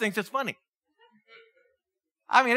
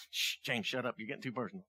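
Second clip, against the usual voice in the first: much softer, hushed shushing; hushing the preacher; 8 semitones lower, 12 dB quieter than usual. Retold or imitated. Congregation hushing the preacher for getting too personal, very softly. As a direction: shush him like a nervous congregation. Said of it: "Everybody's like".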